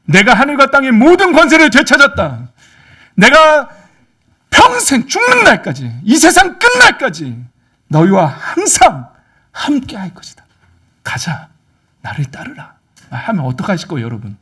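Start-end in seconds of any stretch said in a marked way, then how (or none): 0.0-9.1 loud, high, slow proclamation; drawn-out, thundering promise; Jesus as commander. Acted. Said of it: "예수님이". 9.5-12.8 hushed, solemn command; quiet authority; Jesus as commander. Acted. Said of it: "예수님이".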